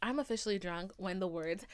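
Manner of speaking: ordinary delivery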